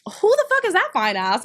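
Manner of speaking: high, wowed re-enacted outburst; thirsty amazement. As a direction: act out being floored by someone gorgeous. Retells reaction to a photo.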